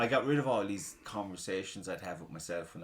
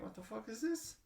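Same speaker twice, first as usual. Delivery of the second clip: baffled; 12 semitones higher, 6 dB quieter than usual. Retold or imitated. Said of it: "I was like".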